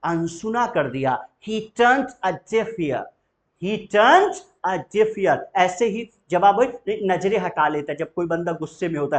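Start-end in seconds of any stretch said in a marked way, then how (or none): 1.5-5.4 "turned" stressed, slow and raised; model phrase. Shown as a demonstration. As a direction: lean on "turned", slowly. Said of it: "teacher repeats the model phrase". ("turned" पर ज़ोर देकर धीरे)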